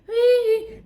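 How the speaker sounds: high gleeful whoop. Retold or imitated. Woman cheering as the attacker runs, high whoop. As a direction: let out a loud triumphant whee.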